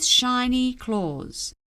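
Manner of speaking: plain reading voice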